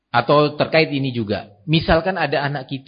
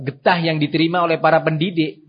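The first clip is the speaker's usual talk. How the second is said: ordinary talk, nothing marked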